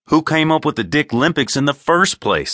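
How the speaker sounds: high, indignant challenge; high-pitched acted outrage; indignant accusation. Simon quarrels with Horn.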